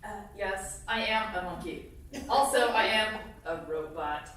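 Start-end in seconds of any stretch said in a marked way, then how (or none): none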